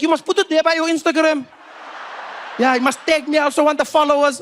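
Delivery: high, eager South African voice; seatmate. Retold or imitated. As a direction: push for the Instagram tag like a fan.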